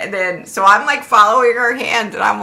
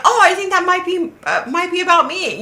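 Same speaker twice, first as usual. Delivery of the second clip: high-pitched mock-hopeful voice; gullible commenter; 8 semitones higher than usual. Imitating viewers who think it's about them, gullible. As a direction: mimic someone eagerly claiming the message.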